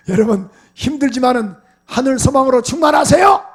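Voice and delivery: loud, rising call to the church; fiery preaching. Acted. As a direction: urge the congregation with full voice. Preaching at full force. (회중에게 힘차게 외치는 설교)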